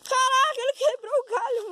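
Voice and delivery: high, excited cry; excited exclaim. Real, not acted. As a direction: blurt it out amazed. Branch snaps, kid exclaims.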